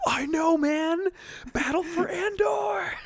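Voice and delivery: very high, slow, loud fanboy shout; excited fan. Pretend. Stranger in the theater turns to share the joy: high fanboy shout. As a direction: cheer like a superfan in the theater. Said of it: "turns around and is like".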